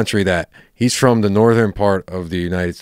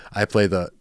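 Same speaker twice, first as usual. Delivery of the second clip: normal talking voice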